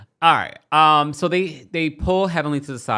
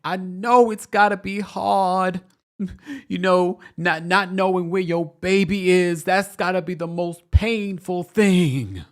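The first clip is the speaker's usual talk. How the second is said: high, slow, overwrought sympathy; hammed-up tearful sermon, loud and drawn out; mock her anguished, quavering concern; mock crying; 8 semitones higher, 5 dB louder, slower than usual. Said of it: "And then she's like".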